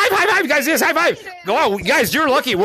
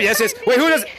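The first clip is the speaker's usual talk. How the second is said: ordinary talk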